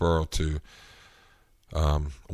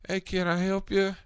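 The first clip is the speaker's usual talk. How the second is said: fake friendly; 13 semitones higher, 4 dB quieter than usual. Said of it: "acted like".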